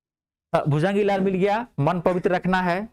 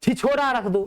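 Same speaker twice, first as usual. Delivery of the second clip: mock quote; 8 semitones higher than usual. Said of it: "William Shakespeare ने कहा".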